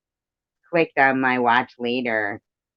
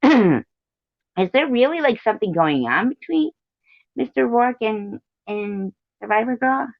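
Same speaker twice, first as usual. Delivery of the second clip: Bobby voice; 10 semitones higher than usual. Retold or imitated. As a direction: squeak it out like Bobby. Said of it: "Bobby said".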